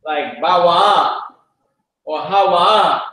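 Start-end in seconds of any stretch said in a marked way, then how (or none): 0.4-1.2 BAWA'A slowly, with a long stressed final AH; accented ending. Shown as a demonstration. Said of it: "we got accents on ours". 2.2-3.0 HAWA'A with a long stressed final AH; accented ending. Shown as a demonstration. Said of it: "we got accents on ours".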